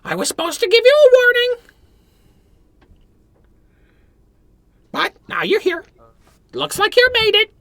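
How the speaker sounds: the bird warns the party, high and loud; talking bird. Acted. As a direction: squawk the warning like a parrot. Talking bird gives warning.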